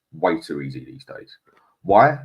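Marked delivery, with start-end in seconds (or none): 1.8-2.2 high, loud rhetorical Why?. Real, not acted. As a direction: pose it to the audience. Rhetorical question to the chat.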